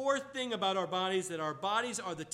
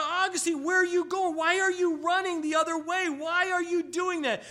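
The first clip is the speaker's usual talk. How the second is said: high, louder calling out after him; yelling woman; 10 semitones higher, 7 dB louder than usual. Retold or imitated. Woman yells after fleeing Augustine, high loud call. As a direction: shout after a man running away. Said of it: "yells out".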